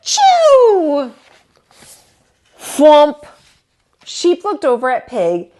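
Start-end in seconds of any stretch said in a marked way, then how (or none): 0.0-1.1 loud high sneeze; big sneeze. Acted. Turkey hanging on the rope, loud sneeze. 2.6-3.2 booming crash word; loud thwomp. A narrator's reading. Narrator voices the fall, loud thwomp. Sound of Turkey falling.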